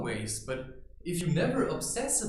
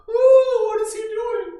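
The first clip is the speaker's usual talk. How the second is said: much higher, much louder squeal; shrill scared child; 15 dB louder than usual. Retold or imitated. Frightened child in the family, shrill squeal. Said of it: "It's like".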